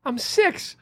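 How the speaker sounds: put-on high squeaky child; little kid voice. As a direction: say it like a tiny boy. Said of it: "He goes".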